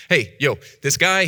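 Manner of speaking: usual speaking voice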